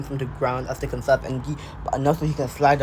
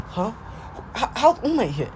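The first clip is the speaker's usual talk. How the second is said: high, stammering confusion; Deku; 9 semitones higher than usual. Acted. Confused student seeing the hero appear, high baffled stammer. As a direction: squeak out a baffled question. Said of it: "Deku would be looking so confused".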